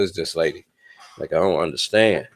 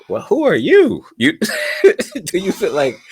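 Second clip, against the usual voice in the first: incredulous outburst; 9 dB louder than usual. Retold or imitated. Retold outburst at woman.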